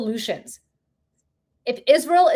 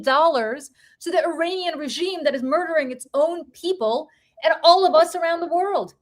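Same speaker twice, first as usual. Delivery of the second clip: impassioned angry rant, pitched high; real indignation, relentless and emphatic; pissed off; 5 semitones higher than usual. Real, not acted. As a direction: hammer each repeated accusation, voice raised in pitch. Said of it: "that pisses me off so much".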